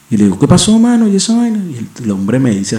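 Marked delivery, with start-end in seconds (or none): 0.4-1.6 much higher pitch, quoting himself; high-pitched re-enacted query; acted puzzled question. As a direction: act out asking a buddy what's up. Re-enacts his question to the friend.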